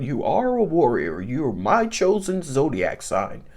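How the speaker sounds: playful mock divine pronouncement, low and unhurried; God voice. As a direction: speak down as God to a chosen one. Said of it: "You hear my God voice coming on".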